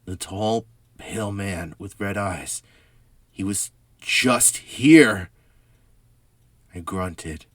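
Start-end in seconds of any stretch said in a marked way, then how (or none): 0.0-5.3 loud, slow frightened insistence; panicked, pleading to be believed; frantic. Acted. Frantic after seeing intruder.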